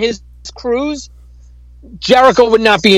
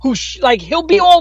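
usual speaking voice

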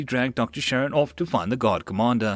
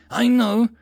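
sudden realisation; 12 semitones higher, 7 dB louder than usual. A narrator's reading.

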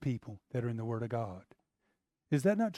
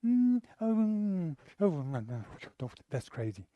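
mock timid murmur. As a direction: imitate a church too timid to speak. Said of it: "The church would just be quiet".

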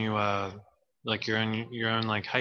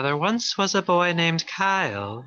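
put-on Irish accent; 6 semitones higher, 4 dB louder than usual. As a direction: recite it in a hammy Irish accent. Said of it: "I'll try to do my best Irish accent".

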